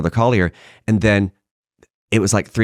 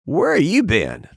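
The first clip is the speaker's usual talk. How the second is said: dry drawl; 8 semitones higher, much slower than usual. Retold or imitated. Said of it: "leans out behind the camera and goes".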